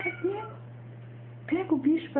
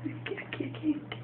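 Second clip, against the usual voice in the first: whispering; 17 dB quieter, much faster than usual. Real, not acted. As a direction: whisper it softly, coaxing. Sussurra ao bebê brincando.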